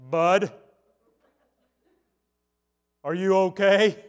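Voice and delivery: imitated dad. Dad at the screen door to his son, calling out. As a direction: call it out as a concerned father. Acting out dad's words to son.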